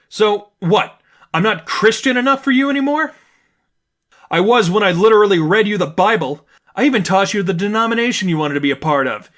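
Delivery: sharp, sarcastic and loud, pitch climbing high; angry condescension. A narrator's reading. Stephanie scolding her friend, angry and condescending. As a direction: lash out with scornful disbelief. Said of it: "anger and condescension mixed".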